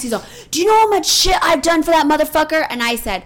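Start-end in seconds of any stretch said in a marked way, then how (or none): 0.5-2.7 higher and louder, fed up; indignant yell. Retold or imitated. Son yelling back at mom about his brother. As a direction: shout it like you've done everything for him. Son's angry retort, retold.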